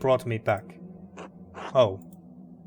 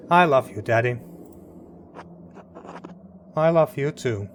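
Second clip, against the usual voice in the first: emotional. Real, not acted. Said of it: "I'm getting too emotional here".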